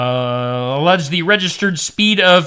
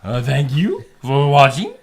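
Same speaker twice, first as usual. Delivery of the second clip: put-on French accent; 3 semitones lower than usual. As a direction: thank you for watching in a hammy French accent. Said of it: "I like your French voice".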